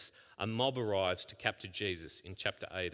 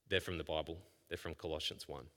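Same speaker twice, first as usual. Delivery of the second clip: normal talking voice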